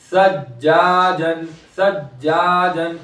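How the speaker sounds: सज्जाजन with जा held long; stretched जा. Shown as a demonstration. Teacher shows one alif's length, जा in सज्जाजन stretched. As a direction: draw out the आ in the middle of सज्जाजन. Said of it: "एक अलिफ के बराबर खींचेंगे".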